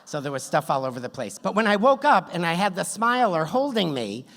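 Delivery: normal talking voice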